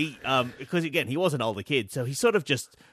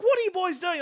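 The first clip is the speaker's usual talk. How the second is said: a high, hammed-up mum calling out; high mock mum; 14 semitones higher than usual. Mum catching the boys at napalm, high mock alarm. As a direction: voice the mum high and shrill-free, catching them out.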